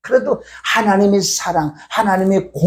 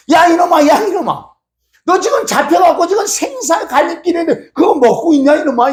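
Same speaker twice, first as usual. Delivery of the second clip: play-acted indignant rebuke; mock scolding; 8 semitones higher than usual. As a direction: scold a caught culprit in raised pitch. Acts out scolding a trapped rat.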